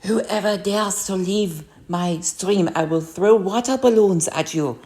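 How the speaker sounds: slow, mock-threatening random accent; invented accent, vaguely German, for a playful threat; made-up accent. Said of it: "Was that supposed to be a German accent?".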